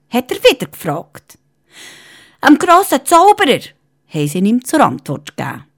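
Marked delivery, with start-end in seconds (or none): 2.5-3.6 raised, high-pitched reply; high workers' answer. Acted. Workers call out the owner's name to the cat, loud and high.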